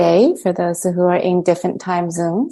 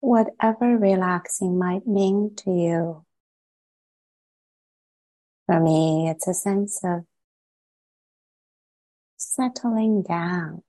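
calm, unhurried meditation guide with long pauses; gently measured meditation voice, settling phrase by phrase; guided meditation. Acted. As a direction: ease listeners into relaxing, leaving space after each phrase.

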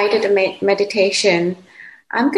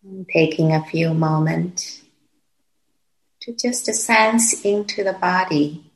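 calm, unhurried meditation voice with long pauses; guided meditation. Real, not acted. Teacher guiding meditators to settle into the body, calm and unhurried. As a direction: guide them gently, phrase by phrase, leaving long silences.

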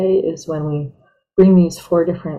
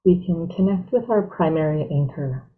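guided meditation; 3 dB quieter than usual. Real, not acted. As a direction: ease them gently into stillness, unhurried.

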